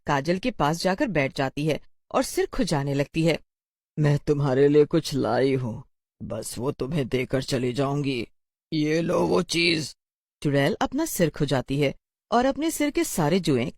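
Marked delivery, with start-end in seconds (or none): 4.0-9.9 low, creepy witch voice; sinister witch. Acted. The witch offers Kajal something, low and sinister. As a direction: lure the child, slowing at the end.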